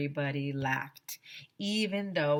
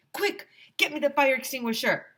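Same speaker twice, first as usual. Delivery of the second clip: higher, louder urgent holler; teacher hollering for the extinguisher; 7 semitones higher, 5 dB louder than usual. A narrator's reading. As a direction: call for it like a panicked teacher. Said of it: "hollered Missus Meyer".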